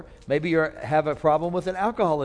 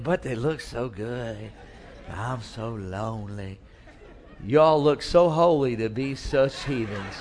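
body's tempting voice; 6 semitones lower, slower than usual. Acted. The body tempting its owner, low slow mock-seductive croon. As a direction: purr it like a tempting body that wants bad company. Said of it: "But your body's saying".

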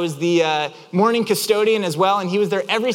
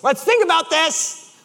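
slow, high-pitched insistence; escalating exasperation; 9 semitones higher, slower than usual. Retold or imitated. Driver pressing his unbothered friend, escalating exasperation. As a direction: repeat it with mounting strain.

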